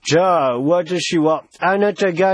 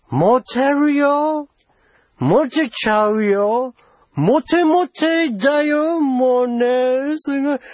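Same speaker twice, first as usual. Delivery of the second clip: enticing; 6 semitones higher than usual. Acted. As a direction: tempt them in a lilting high voice. Coaxing with promises of popularity.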